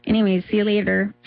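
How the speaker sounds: scolding Duluth local; thick Duluth accent, politely disapproving. Shown as a demonstration. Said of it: "I can do it Duluth".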